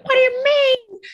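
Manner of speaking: very high, loud squeal of disbelief; incredulous. Retold or imitated. Girlfriend told it is not her fault, incredulous. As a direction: squeal it out, hammed-up and baffled.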